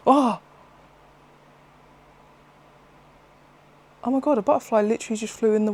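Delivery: sudden high gasp, then fast excited words; real surprise. Real, not acted. Vlogger startled by a butterfly mid-video, real surprise. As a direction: caught off guard by a butterfly, talking fast.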